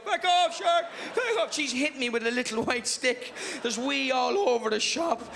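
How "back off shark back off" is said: loud, high panicky back off; laughing yell. Retold or imitated. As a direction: fend off the shark, half laughing.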